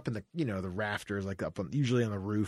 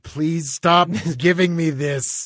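slow, louder whiny plea; pleading gerbil; 6 semitones higher, 8 dB louder, slower than usual. Pretend. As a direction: beg like a tiny pet. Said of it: "What would Fluffhead have said?".

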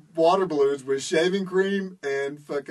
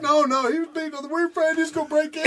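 mock panicked pleading; 9 semitones higher, faster than usual. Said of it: "They're like".

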